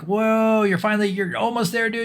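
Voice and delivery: high-pitched mock excitement; excited encouragement. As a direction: cheer a buddy on as the dive computer. Voices dive computer cheering diver on.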